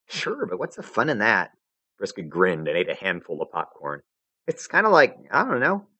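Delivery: put-on brash high casual girl; cocky troll girl shrugging; Vriska voice.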